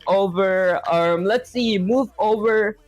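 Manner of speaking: very slow, typing as he talks; drawn-out words while typing; slow dictation. Real, not acted. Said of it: "slowly dictating while typing".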